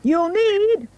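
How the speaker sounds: very high defiant squeak; defiant piggy. A narrator's reading. Third pig defying the wolf, high and cocky. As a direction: cry it out like a cocky little pig. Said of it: "pig said".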